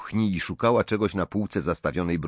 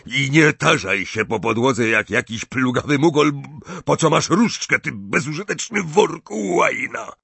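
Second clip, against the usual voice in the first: raised, higher angry tirade; angry character voice, building higher; roaring abuse; 5 semitones higher, 3 dB louder than usual. A narrator's reading. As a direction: berate her with rough contempt. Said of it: "ryknął na nią Gaunt".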